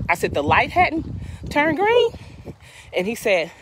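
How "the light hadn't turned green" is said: incredulous. Retold or imitated. Sarcastic question to the officer.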